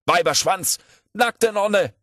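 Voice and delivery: high, loud cries; shrieked outburst of a lecturer who won't stop; acted shouting. A narrator's reading. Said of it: "schrie Puskow". (laut und hoch herausgeschrien)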